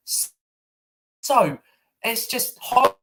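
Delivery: normal talking voice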